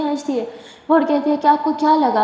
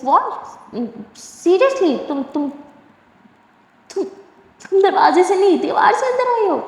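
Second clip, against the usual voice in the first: rising, stunned questions, voice jumping high; can't believe she came over the wall; shocked disbelief; 7 semitones higher, slower than usual. Acted. Durga stunned that Simran jumped the wall, shocked and high.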